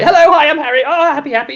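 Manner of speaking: high, eager hello; really happy greeting. Retold or imitated. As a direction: greet him bursting with joy. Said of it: "you know, really happy".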